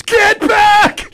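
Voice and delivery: very high, very loud sudden yell; explosive shouted command; imitated male warning shout. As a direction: mimic an urgent machine warning at full volume. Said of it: "a male voice goes".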